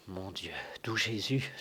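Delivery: soft, breathless fearful whisper; frightened whisper. Acted. Old servant whispers his fear as the duel looms. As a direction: whisper the prayer under your breath.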